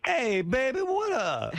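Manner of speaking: very high, hammed-up hollered greeting; mock street voice. Shown as a demonstration. Host demonstrating for the guest, high caricatured street voice. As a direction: pitch it way up, exaggerated street slang.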